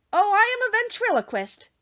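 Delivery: a touch of a sheepish small man; little man Oz. A narrator's reading. The wizard confesses to Dorothy, lightly voiced little man.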